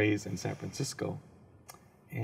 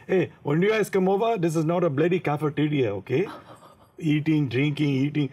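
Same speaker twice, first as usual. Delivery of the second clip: put-on louder, scolding grandpa in an Indian accent; strict grandpa's Indian accent; 4 semitones higher, 6 dB louder than usual. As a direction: play the cheap, strict grandfather telling the kids off. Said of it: "my grandpa, he was like".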